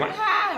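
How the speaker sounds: sudden high piercing yell; a sharp fake scream to disorient; loud scream. Shown as a demonstration. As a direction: scream it right into the ear. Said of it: "even a loud scream".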